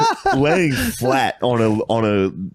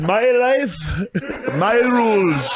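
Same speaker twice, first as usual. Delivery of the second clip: high, drawn-out mock declaration; defiant comeback; 12 semitones higher, much slower than usual. Answering the man who walked in, defiant. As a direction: proclaim it in a raised voice, stretching the end.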